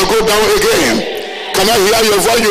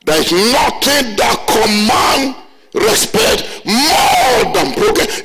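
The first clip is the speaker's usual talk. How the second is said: slow, weighty restatement, each word leaned on; slow emphatic repeat; much slower than usual. Shown as a demonstration. Pastor repeats his key line slowly for emphasis. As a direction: drive the point home slowly. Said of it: "What did I say?".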